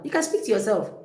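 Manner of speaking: ordinary delivery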